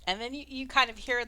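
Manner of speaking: ordinary delivery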